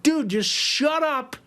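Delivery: mock-annoyed bandmates. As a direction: brush off the driver, exasperated.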